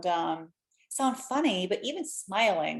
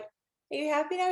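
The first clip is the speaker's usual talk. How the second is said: much higher, playful question; teasing check-in; 8 semitones higher than usual. Retold or imitated. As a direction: tease the little boy up high. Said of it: "I'm like".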